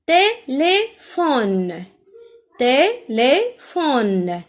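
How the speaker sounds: Téléphone very slowly, té - lé - phone; syllable by syllable. Shown as a demonstration. Teacher lets pupils hear the é, slow split syllables in Téléphone. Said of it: "j'entends le son E".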